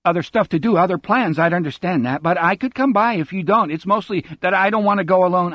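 fast, breathless run-on talk without pauses; droning rush. Acted. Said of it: "droning along with none of the stops".

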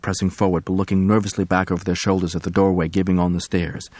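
plain reading voice

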